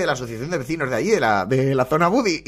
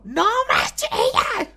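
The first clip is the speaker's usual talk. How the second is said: squeaky, much higher mock shriek; slower than usual. Host mocking the contestant's yell, squeaky mock shriek. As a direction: send up her shrill telling-off.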